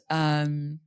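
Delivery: ordinary talk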